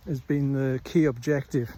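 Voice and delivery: usual speaking voice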